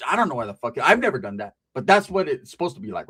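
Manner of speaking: ordinary talk, nothing marked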